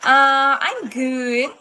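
normal talking voice

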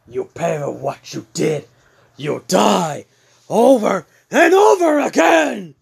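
threat building to a high scream; acted rage climbing to a loud shriek; furious threat. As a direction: start contained, end screaming in rage.

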